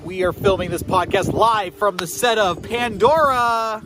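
big booming announcer intro; loud and slow, hyping the crowd; announcer shout. Acted. Shouted intro opening theme.

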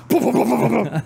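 high, rapid-fire imitated gunshots; mouthed gunfire booms. Player imitating the game's shotgun fire, excited mouthed booms.

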